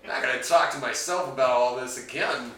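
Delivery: usual speaking voice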